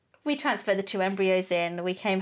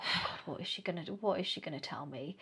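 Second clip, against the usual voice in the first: hushed dread; 10 dB quieter, faster than usual. Retold or imitated. Retells dread before scan news.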